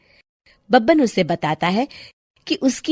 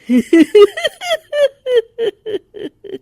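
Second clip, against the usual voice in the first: high, loud evil cackle; witch cackle; 4 dB louder than usual. Acted. Witch cackles as she steps out of the painting. Witch laughs after appearing.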